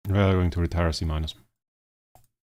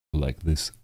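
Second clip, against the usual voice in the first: lightly voiced dark tone, barely lower; a hint of a dark voice; 3 semitones lower than usual. Shown as a demonstration. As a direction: try a dark voice, only half committed. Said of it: "like, in a really dark voice".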